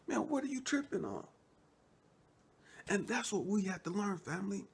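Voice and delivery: rising pitch, building sermon intensity; preaching. Acted. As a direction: build it up like a preacher rousing the congregation. Preaching builds to full force.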